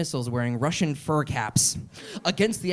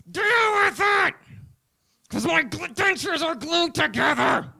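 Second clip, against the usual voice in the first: high, loud strained mock shout; a hammed-up, shouting old Eastwood; old Clint Eastwood shout; 12 semitones higher, 5 dB louder than usual. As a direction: yell through clenched dentures.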